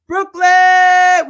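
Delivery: a big, high, loud hype call of the city name; excited shout-out. Real, not acted. Host hypes a viewer from Brooklyn, a loud excited call. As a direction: belt it out like a host spotting a fan.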